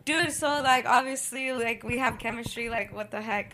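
quiet. Pretend. Rehearsing what she'll tell him.

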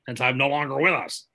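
lightly put-on old performer's voice; impression of an old star. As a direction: play a departed star excusing themselves. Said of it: "Wilford Brimley and Lucille Ball".

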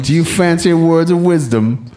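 hammy fake British accent; bad British accent. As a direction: put on a clumsy British accent. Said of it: "Don't try a British accent if you can't actually accomplish it".

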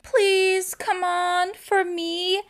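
high, soft mock pleading; begging Spencer. Podcaster retelling Spencer begging his girlfriend, in a high soft plea. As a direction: whine it like a begging boyfriend. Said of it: "He's like".

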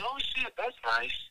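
high, impressed "oh shit"; surprised. Retold or imitated. Diego answering the escort, high and impressed. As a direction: sound pleasantly caught off guard. His reply to her Milwaukee trip.

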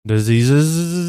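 drawn-out Dracula-like horror voice; Christopher Lee. Retold or imitated. Host echoing co-host's impression, drawn-out horror movie voice. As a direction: hold the hissing end, hammed up. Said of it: "drawn out, almost Dracula-like".

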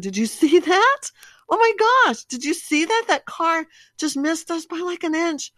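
high, loud, breathless alarm; panicked excitement. Retold or imitated. Wife to husband after a near crash, high panicked alarm. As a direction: shriek it out, grabbing his arm. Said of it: "I grab his arm and I said".

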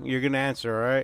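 normal talking voice

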